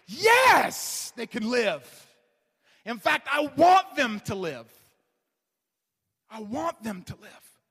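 a shouted, high YES, then strong declaration; emphatic yes. Acted. God answering his people, an emphatic shouted yes. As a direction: burst out with God's answer. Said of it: "his answer is an emphatic".

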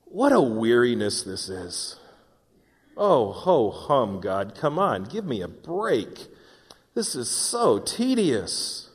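drawn-out, long-suffering groan at God; bored, weary complaint. Acted. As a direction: drag it out like it's all such a chore. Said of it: "But you say".

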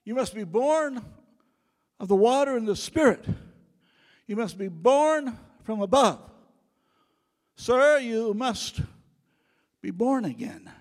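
loud, high proclaiming voice; preaching at full force. Acted. Preacher booming Jesus' words to the congregation in full force. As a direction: thunder it out like a revival preacher.

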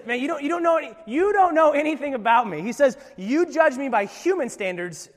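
loud, high rebuke; Jesus puts the Pharisees in their place; forceful rebuke. Acted. Preacher as Jesus firing back at the Pharisees, loud.

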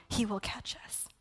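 hushed closing promise; whispered promise. Acted. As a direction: let it land in a hush.

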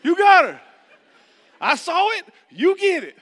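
yelling high and loud across the beach; frantic shout to wife. Retold or imitated. Dad yelling to his wife to grab their daughter, frantic shout.

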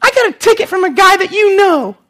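put-on girl's voice. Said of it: "she goes".

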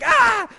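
sharp high yelp of pain; sudden ouch cry. Retold or imitated. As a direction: yelp like a wasp just stung you. Stung on the neck, yelps.

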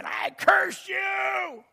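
high, drawn-out mock curse; mock thundering curse. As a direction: stretch it out high like a hammed-up curse. Mock of a cursing God.

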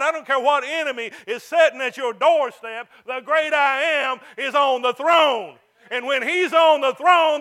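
full-force sermon climax; preaching at full force. Acted. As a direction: shout it to the back of the church.

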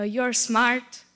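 normal talking voice

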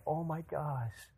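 slow, soft, hushed wonder; awed boy. Retold or imitated. Boy thinks he started the car himself, softly awed. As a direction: breathe it out in amazement. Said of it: "the boy is like".

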